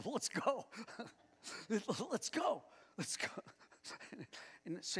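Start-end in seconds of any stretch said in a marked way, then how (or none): 0.0-3.3 put-on high, bright eager boy's voice, much higher; boy pushing to climb now, much higher and eager. Youth leader voices the boy urging him to go, much higher. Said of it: "he goes, he goes".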